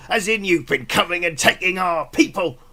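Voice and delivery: loud, high accusing kobold; a kobold shouting at the gnome, indignant and loud. Acted. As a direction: hurl the accusation.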